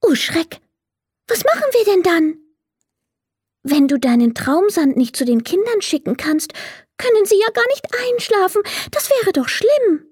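high fairy voice, worried and sad; a tiny fairy alarmed for the children; sad little fairy. A narrator's reading. The little fairy worries about the sleepless children, high and sad. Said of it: "merkte die kleine Fee traurig an".

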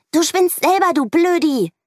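put-on high, loud yelling child. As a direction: scream it back in a rage. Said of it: "schreit Mieke". (laut und hoch geschrien)

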